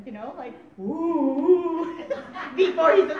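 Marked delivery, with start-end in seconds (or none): 0.8-2.0 playful ghostly "ooh"; higher, louder mock haunting wail; mischievous spooky ooh. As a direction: tease them with a ghost noise. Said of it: "he isn't even mischievous with them".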